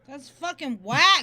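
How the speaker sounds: loud outrage. Real, not acted. Outraged reaction to pardon.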